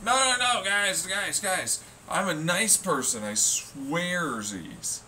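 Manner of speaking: high whiny plea of innocence; mock pleading. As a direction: whine like a caught kid begging. Mimicking hackers pleading innocence.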